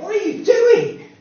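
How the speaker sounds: high, loud, alarmed shout; screaming out. Acted. Conscience confronting a believer falling into temptation, screaming out. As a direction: shout it as an outraged rebuke. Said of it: "screams out".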